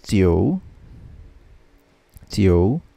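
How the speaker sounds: Tio as tee-oh-oo, dipping low then rising. Shown as a demonstration. Teacher recapping number nine, Tio in low dipping third tone. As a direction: sink low on Tio and curl upward. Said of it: "Number nine is J I O U".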